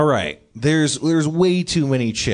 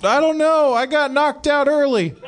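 whiny junkie; 11 semitones higher than usual. Acted. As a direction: whine like a dazed junkie. Junkie NPC whiny reply.

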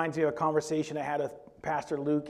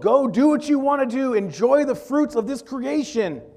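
loud, high-pitched grant of freedom; expansive proclamation; 9 semitones higher, 6 dB louder than usual. Acted. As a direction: proclaim it freely as God to Adam. Voicing God's grant to Adam.

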